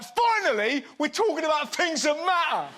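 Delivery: mock the outraged public with a big sarcastic cry; mock outrage. Mocking outraged tweeters.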